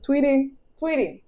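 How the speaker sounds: high, soft call to someone off mic; sing-song call, turned away and quiet; calling Tweety. Real, not acted. Calling out to Tweety mid-video, high and soft.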